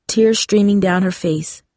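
ordinary delivery

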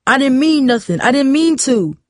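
high-pitched, defensive pleading; pleading excuse. A narrator's reading. Cornered boy excuses himself to angry father, pleading.

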